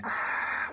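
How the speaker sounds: raspy whispered ah from the back of the throat, mouth shut; Ujjayi breath. Shown as a demonstration. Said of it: "it sounds like this".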